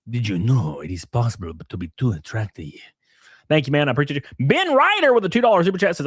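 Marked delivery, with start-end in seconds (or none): 0.1-2.7 Antonio Banderas. Retold or imitated. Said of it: "My favorite [name] impression is Antonio Banderas".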